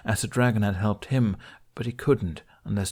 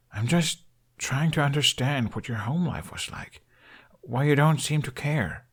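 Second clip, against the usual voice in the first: a hint of a higher, pressing young man; lightly voiced young Hiccup; 6 semitones higher than usual. Hiccup pressing Toothless about his home, lightly higher. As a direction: Hiccup probing gently, a little higher.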